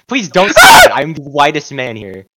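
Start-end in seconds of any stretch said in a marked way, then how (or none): 0.1-0.9 high, loud pleading protest. Real, not acted. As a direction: beg him loudly to stop. Protesting a friend's joke.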